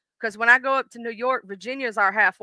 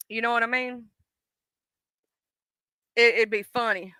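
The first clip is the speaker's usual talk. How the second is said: usual speaking voice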